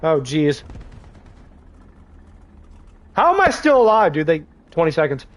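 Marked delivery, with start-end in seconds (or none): 3.1-4.1 high, incredulous exclamation; surprised disbelief. Real, not acted. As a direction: marvel that you survived. Surviving against odds.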